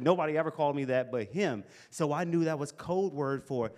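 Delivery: ordinary talk, nothing marked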